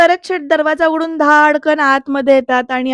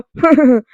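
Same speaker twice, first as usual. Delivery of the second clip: short scornful laugh, a little louder; mocking laugh; 5 dB louder than usual. Angry husband laughs scornfully at the news. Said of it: "Varad ना हसायला लागतो".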